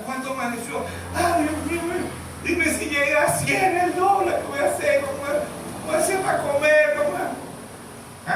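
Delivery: high, fretful worried voice; a scared woman panicking over prices; anxious sister's lament. Retold or imitated. Frightened sister about the dollar.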